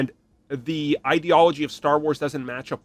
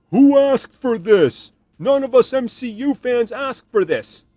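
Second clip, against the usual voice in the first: mock whiny fan; 7 semitones higher, 6 dB louder than usual. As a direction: sneer it like an entitled fan whining. Mocking complaining fans.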